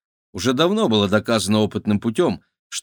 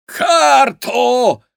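high, loud shrill shriek; 14 semitones higher, 7 dB louder than usual. A narrator's reading. Mukish waves the lit dynamite, loud shriek. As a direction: screech the demand. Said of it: "взвизгнул Мукиш".

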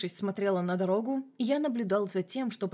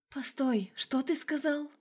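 higher, whispered in horror; frightened whisper; 5 semitones higher, slower than usual. A narrator's reading. Horrified Masha whispers to Vlad, frightened whisper. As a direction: breathe it out, scared.

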